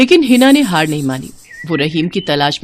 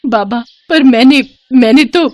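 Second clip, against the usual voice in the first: higher, louder broken protest; distraught faltering protest; 6 semitones higher, 5 dB louder than usual. Acted. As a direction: falter while pleading with the fakir. (घबराई, रुआंसी दलील)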